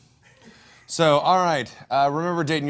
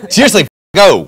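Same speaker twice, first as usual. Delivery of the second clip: exasperated; 5 semitones higher, 13 dB louder than usual. Real, not acted. Insists, covered in cake.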